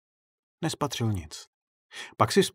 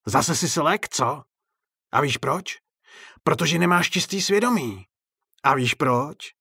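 high, gloating clown voice; raised, mocking jibe; taunting clown; 4 semitones higher, 4 dB louder than usual. A narrator's reading. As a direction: taunt him with needling questions. Clown taunts director from balcony.

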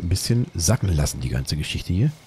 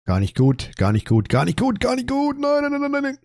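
rising panic. Real, not acted. Panic as the fight goes wrong.

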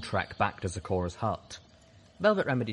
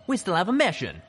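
high, loud insistence; defiant shout; 10 semitones higher, 6 dB louder than usual. A narrator's reading. Doppelganger protests to the comrades blocking him, defiant shout. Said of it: "the Calamity doppelganger shouted".

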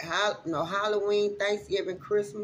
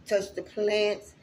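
usual speaking voice

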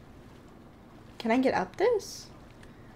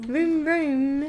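higher imitation of car noises; playful meme-kid vrooming; mom's car meme voice; 4 semitones higher than usual. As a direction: mimic the meme, pitched up. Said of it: "the voice pretty well".

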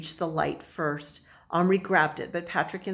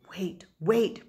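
breathed whisper; 3 semitones higher than usual. A narrator's reading. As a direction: breathe it so nobody hears. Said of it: "breathed Patrick".

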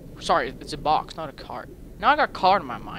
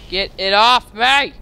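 loud panicked yell, slow; shouting at the screen to get it off; 2 semitones higher, 6 dB louder, slower than usual. Real, not acted. Player caught by a monster, shouting in panic. Creature grabs player character.

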